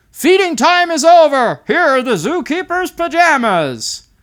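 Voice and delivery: high, loud zookeeper dad; dad ending feeding time; playful dad voice. Acted. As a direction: call it out like a jolly zookeeper. Dad voiced in picture story.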